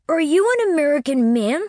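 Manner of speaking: put-on high, lively boy's question; eager little boy asking; vivacious child. A narrator's reading. Boy questioning Winterbourne, high and lively. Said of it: "pursued this vivacious infant".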